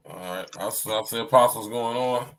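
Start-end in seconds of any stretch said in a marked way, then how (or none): none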